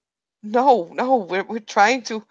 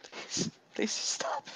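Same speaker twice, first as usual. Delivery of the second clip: pained plea; 15 dB quieter, slower than usual. Acted. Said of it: "she screams... almost a wail".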